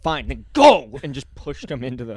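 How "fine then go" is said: loud, fed-up shove-off; fed-up bouncer. Retold or imitated. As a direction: bark it as the bouncer loses patience. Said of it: "pushed the I D into his chest and went".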